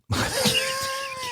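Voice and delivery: high laughing squeal; burst of laughter. Real, not acted. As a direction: crack up into a shriek. Breaks off laughing mid-accent.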